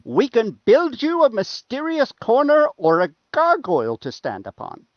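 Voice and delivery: lightly voiced adventurer calling over; in-character call. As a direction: call up to them with a put-on voice. Player speaks in character to kobolds.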